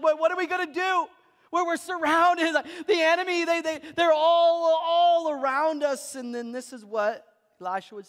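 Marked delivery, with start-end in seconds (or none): 0.0-6.1 higher, louder frightened plea; panicked servant. Retold or imitated. Servant to Elisha about the surrounding army, panicked. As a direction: sound cornered and scared.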